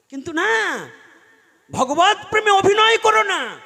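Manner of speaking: high, loud preacher's warning; forceful admonition. Real, not acted. Preacher warning devotees not to fake love of God, forceful admonition. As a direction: cry it out as an urgent command.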